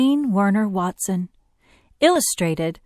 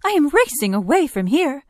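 higher-pitched, mildly startled animal voice; a touch of alarm, pitched up; startled kudu; 7 semitones higher, 4 dB louder than usual. A narrator's reading. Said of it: "said the startled kudu".

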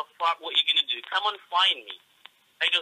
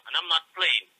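ordinary talk, nothing marked

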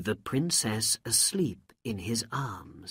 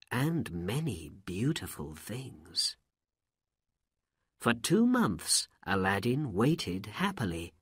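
ordinary delivery

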